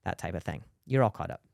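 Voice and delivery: ordinary talk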